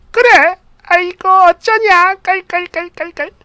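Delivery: high, mock-delighted cackling; gleeful mother-in-law. Mother hearing they can't visit her parents, high mock glee. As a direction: fake sympathy tipping into high, spelt-out laughter. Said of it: "한박 웃음을 지으며 어쩔 줄은 몰랐다고".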